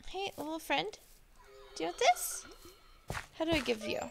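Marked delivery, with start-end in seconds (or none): 0.1-2.5 cooing to a pet. Real, not acted. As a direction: coo gently at the little creature. Talking sweetly to an allay.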